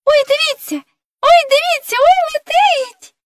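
very high, squealing doll voice; alarmed cry. Acted. Doll warns crew of the flying serpent, crying out. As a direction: cry out in alarm, stretching the last word. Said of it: "почувся крик ляльки-гальки".

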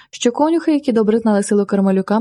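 ordinary delivery, nothing marked

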